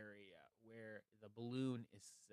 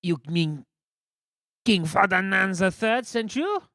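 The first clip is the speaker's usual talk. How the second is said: guard voice; 8 semitones higher, 16 dB louder than usual. Acted. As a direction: bellow like a pompous royal guard.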